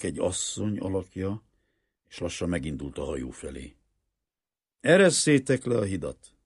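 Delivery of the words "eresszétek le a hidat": louder, higher order; commanding. A narrator's reading. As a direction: give it as a firm order.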